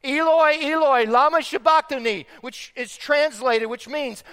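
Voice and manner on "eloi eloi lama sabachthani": raised, high cry from the cross; anguished biblical cry, acted by a preacher; crying out loudly. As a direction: call it out loud and high. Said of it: "cried out in a loud voice".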